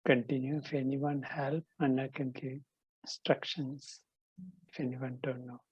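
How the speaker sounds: softly, under the breathing; quiet instructions. Real, not acted. Quiet instructions during practice. (тихие указания во время практики)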